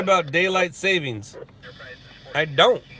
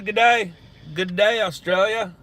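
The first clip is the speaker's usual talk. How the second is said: mock Australian greeting; 4 semitones higher than usual. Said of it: "I know it's rude to say".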